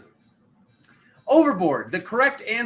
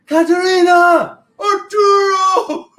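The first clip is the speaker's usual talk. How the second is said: loud, high, drawn-out shouted call; movie quote shouted; 12 dB louder, slower than usual. Acted. Host shouting a movie quote for a quiz. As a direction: bellow it like a desperate movie scene. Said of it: "what movie that's from".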